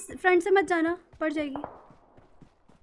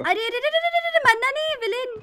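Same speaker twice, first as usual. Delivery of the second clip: high, loud panicked yelling; alarmed shouting; 9 semitones higher, 7 dB louder than usual. Real, not acted. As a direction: shout in panic to save him. Teammate about to die.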